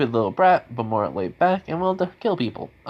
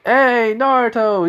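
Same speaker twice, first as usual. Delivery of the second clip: much higher, louder and slower, a put-on voice; friendly villager voice; 12 semitones higher, 7 dB louder, slower than usual. Old friend hailing Naruto in a high, loud put-on voice. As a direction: call out in a high, stretched greeting. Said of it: "He goes, they go".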